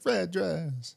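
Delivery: high, breathy mock of the singer's line. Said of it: "in the Jonas Brothers song when he's like".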